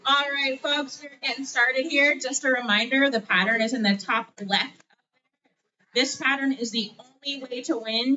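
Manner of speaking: bingo caller. Acted. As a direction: call out the pattern to the room.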